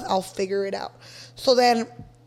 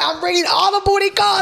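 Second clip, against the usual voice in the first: higher, louder gleeful boast; excited; 9 semitones higher, 5 dB louder than usual. Retold or imitated. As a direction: crow about the new back door. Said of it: "I'm like".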